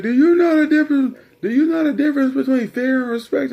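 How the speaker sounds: loud, high, fast imitation of Dad; badgering dad. Dad quizzing son on fear versus respect, badgering. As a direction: fire the question twice at a yell.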